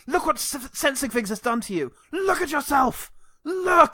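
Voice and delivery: rising into a mock yell; mock intense shout. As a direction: ham up the dramatic look-at-yourself rant. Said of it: "he's like".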